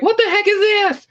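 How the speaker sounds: sudden high squeaky outrage; high startled complaint, mostly in pitch; mock outraged Netflix. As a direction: play a scandalized studio exec, pitch jumping way up. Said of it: "be like".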